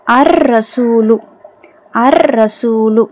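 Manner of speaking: slow "அர் ரசூலு", no L, long rolled double R; silent lam. Shown as a demonstration. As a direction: roll straight from A into a held R in "அர் ரசூலு". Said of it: "லாம் உச்சரிக்கப்படலை". ("அர் ரசூலு" லாம் இல்லாமல், ர் நீட்டி)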